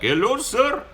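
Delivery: high, polite mock Indian accent, slow on the intro; imitating an Uber driver's Indian accent. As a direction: play the overly formal driver with a put-on Indian accent. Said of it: "and he's like".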